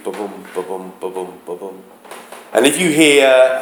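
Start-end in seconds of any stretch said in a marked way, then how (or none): 0.0-1.8 soft, low ba-BOOM beat, stress on each BOOM; quiet da-DUM rhythm demo; iambic beat. Shown as a demonstration. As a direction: tap out "ba-boom" softly with the weight on "boom". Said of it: "that iambic beat".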